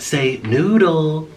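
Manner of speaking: slow, drawn-out "Say noodle" for the bird; coaxing the parrot. Shown as a demonstration. Owner teaching his parrot a word, slow coaxing. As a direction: stretch out "noodle" so the parrot can copy it.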